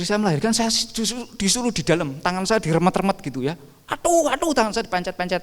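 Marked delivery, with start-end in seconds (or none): 3.9-5.4 high imitated cry of pain; mock ouch. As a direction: yelp as if your hand is being crushed. Wife squeezing his hand in labour. (aduh kesakitan yang ditirukan, nada tinggi)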